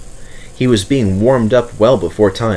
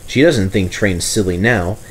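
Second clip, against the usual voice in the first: ordinary delivery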